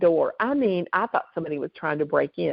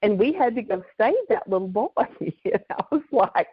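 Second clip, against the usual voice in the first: usual speaking voice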